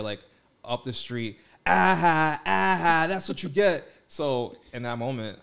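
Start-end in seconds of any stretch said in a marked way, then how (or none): 1.6-3.9 1 mocking taunt. Chasers taunting from up the street.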